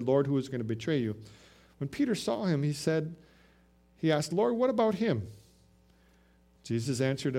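measured, solemn Bible reading; steady, reverent scripture read. Acted. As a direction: read the gospel aloud to the congregation. Reads scripture passage aloud.

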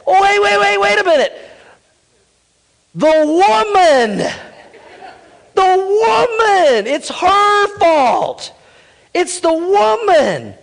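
high, loud panicky blame; whiny excuse. Acted. Busted Adam pointing at the woman, high and panicky. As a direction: squeal out the excuse, slow and desperate. Preacher acting Adam blaming Eve.

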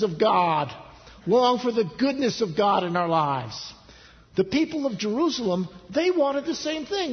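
high, forceful sermon climax; impassioned preaching. Real, not acted. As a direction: build to a stretched, loud, emphatic peak.

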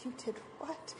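hushed, disbelieving question; softly aghast, holding it in; suppressed shock. Retold or imitated. Mother hearing her son's confession, quietly shocked. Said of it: "I'd be in there going".

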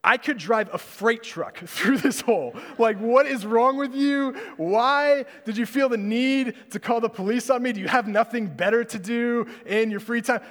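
the outburst he held back, yelled at the neighbor lady; angry outburst. Pretend. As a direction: let it all explode at her. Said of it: "my will was to say".

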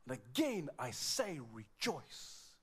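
slow, deliberate stress on "again" and "rejoice"; emphatic. Acted. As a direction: spell out "again I say rejoice" slowly, weighting each part. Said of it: "would, would emphasize".

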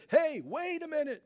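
anxious to explain himself, eager to be understood; a hammed-up, pleading "let me clarify"; pleading backpedal. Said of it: "Jesus didn't say".

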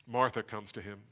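ordinary talk

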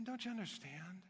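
gentle Jesus. Retold or imitated. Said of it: "He's saying".

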